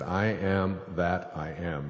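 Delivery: I am that I am, drawn out word by word; slow, solemn declaration. Acted. Telling the audience what God said, slow and solemn. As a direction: savor the divine name slowly. Repeating God's name slowly.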